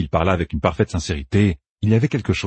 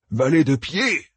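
dubious; 7 semitones higher, slower than usual. A narrator's reading. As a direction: repeat "Valet de pied" doubtfully, as if tasting it. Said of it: "répéta Noal dubitatif".

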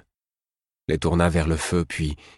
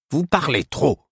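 put-on higher, surly old woman; 9 semitones higher than usual. A narrator's reading. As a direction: snap it grumpily. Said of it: "répondit Caudron d'un ton revêche".

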